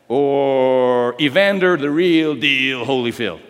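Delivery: slow, drawn-out fight announcer; mock ring announcer. Preacher naming a boxer like a ring announcer, drawn out.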